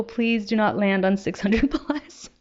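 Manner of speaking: ordinary talk, nothing marked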